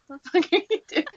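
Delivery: high yell that cracks into laughter; mock outraged yell. Emma walking in on Killian, laughing mock outrage. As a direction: laugh through a fake outburst.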